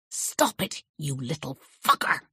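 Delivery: angry whispered hiss. Acted. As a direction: spit it out in a harsh whisper. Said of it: "I hissed".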